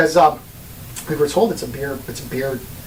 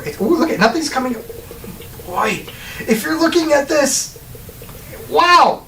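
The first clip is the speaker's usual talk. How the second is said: high, slow, amazed commentary; excited; 10 semitones higher, slower than usual. Real, not acted. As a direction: marvel at it as it happens. Excited watching beer pour trick.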